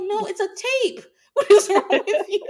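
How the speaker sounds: high, loud mock scolding through laughter; shrieking with laughter; incredulous laugh. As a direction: laugh at him in disbelief. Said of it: "I'm like".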